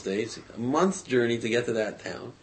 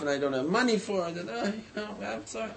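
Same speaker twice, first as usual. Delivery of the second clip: trailing off high and soft; apologetic woe; 7 semitones higher, 5 dB quieter, faster than usual. Retold or imitated. Brother confesses he has no money, apologetic and sad. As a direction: act out a downcast man petering out apologetically.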